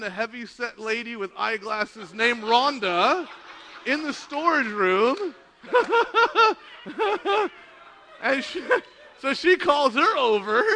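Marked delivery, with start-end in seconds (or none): 5.6-8.2 loud, high burst of laughter; real laughter. Real, not acted. Laughing at the second Rhonda turning up, real laughter. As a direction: crack up at your own story. Cracks up at own story.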